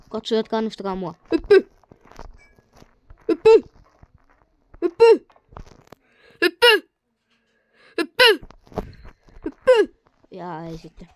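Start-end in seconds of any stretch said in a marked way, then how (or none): 1.3-9.9 shouted command. Real, not acted. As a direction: yell Hyppy! high and loud. Shouting jump in game. (huutaen korkealta)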